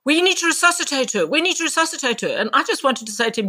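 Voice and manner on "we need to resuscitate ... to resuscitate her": much higher, urgent repeated demand; imitating a panicky doctor's insistence; insistent. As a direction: push the same order again, pitched up and pressing. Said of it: "he kept saying".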